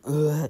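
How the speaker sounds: loud high hungover groan; sick groan. Retold or imitated. Hungover morning, groaning sick before school. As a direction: groan like you're about to throw up. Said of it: "I was fucking just fucking".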